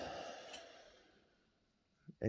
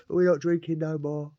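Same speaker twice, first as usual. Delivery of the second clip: slower, louder, higher, each word laid down; huffy final word; 6 semitones higher, 4 dB louder than usual. Retold or imitated. To his cellmate, tipping out the hooch, in a huff. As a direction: slam it shut in a huff.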